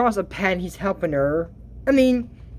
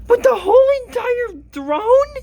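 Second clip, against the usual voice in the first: incredulous; 4 dB louder than usual. Real, not acted. Mocking the packed throne.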